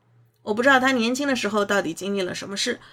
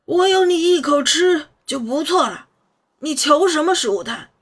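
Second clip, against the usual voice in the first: loud, higher gruff scolding; mock-angry old master; scolding old man; 6 semitones higher, 6 dB louder than usual. A narrator's reading.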